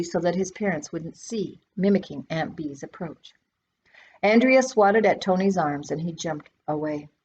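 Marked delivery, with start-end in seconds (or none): none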